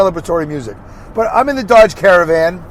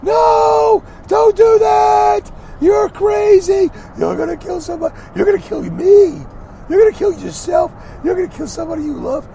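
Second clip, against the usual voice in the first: very high, stretched-out mock panic; hammed-up falsetto hysteria, dragging the words out; panicky, overreactive voice. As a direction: shriek like an absurdly overreacting scold. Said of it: "that panicky, overreactive voice".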